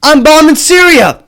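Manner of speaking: loud, high, swaggering mock Biden; cocky caricature of the president taunting critics. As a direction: bark it like a bully daring them. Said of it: "Joe Biden goes".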